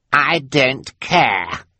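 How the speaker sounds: put-on high, very drawn-out spoiled-child voice; bratty defiance. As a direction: dismiss him like a spoiled brat. Brat refuses to listen.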